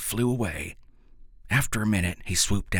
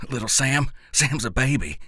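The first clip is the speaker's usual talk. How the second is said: curt and higher, a bit louder; short-like; 6 semitones higher, 3 dB louder than usual. A narrator's reading. Boy defending his baby brother to the professor, curt and sharp. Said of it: "I said, short-like".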